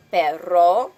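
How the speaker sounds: "perro" loud with an accented R; Spanish R accent. Shown as a demonstration. Teacher giving an example, loud accented "perro". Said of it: "we have that R accent in it".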